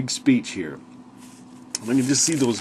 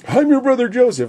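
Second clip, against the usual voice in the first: exclaimed reveal; 13 semitones higher, 7 dB louder than usual. Retold or imitated. Joseph's reveal retold again.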